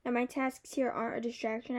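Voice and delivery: usual speaking voice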